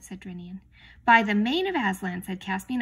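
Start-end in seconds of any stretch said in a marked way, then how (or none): 1.0-2.2 loud, indignant outburst; angry oath. A narrator's reading. King swears at his defiant crew, loud and angry. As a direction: swear it out at full voice.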